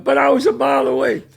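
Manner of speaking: high, loud protest as he's dragged off; desperate protest. Retold or imitated. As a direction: whine it while being taken away. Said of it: "he's going".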